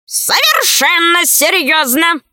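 high, slow character bird voice; talking bird head. A narrator's reading. Left head backs up the right, high bird voice. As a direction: chime in as the second bird head. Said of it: "поддержала её левая".

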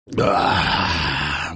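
low, blissful groaning "да"; moan of bliss. Acted. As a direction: release it like sweet relief. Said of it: "стон блаженства".

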